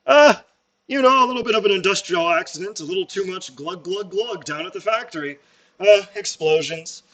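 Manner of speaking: high hearty boss excuse; jolly boss. Acted. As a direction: brush off the injury cheerily. Boss character answers in voice.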